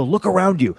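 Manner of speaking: high-pitched, insistent appeal; worked-up coaching; urgent exhortation. Retold or imitated. Retold backstage pep talk.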